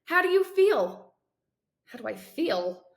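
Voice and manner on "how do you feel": lightly voiced cheerful doctor, higher and louder; upbeat doctor. Doctor at the bedside asks the patient, lightly voiced and upbeat. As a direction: ask it brightly, a touch loud.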